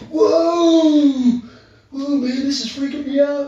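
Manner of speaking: high, loud startled cry of alarm; a scared young man yelping in shock; freaked-out shout. Retold or imitated. Retelling the client seeing the demon, high startled shout.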